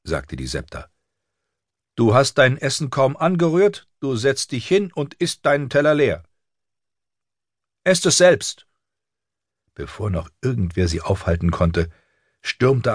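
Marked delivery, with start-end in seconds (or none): 1.9-6.2 high-pitched strict governess; stern telling-off in a raised pitch; scolding septa. A narrator's reading. Septa scolds girl over uneaten food. 7.8-8.7 put-on high, loud girl's outburst; cheeky child's angry comeback; defiant retort. A narrator's reading. Girl snaps back at septa.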